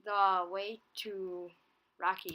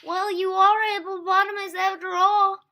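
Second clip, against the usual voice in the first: high, loud chirpy little voice; Teeny's voice; 12 semitones higher, 17 dB louder than usual. A narrator's reading. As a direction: squeak it out brightly. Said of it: "said Teeny".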